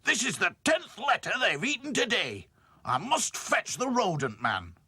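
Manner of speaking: loud, irritated boss voice; cross. Acted. Mister Grimes cross about the letters. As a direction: grumble it out crossly.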